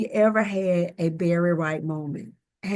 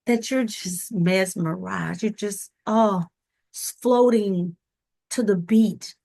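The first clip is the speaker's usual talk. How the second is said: ordinary delivery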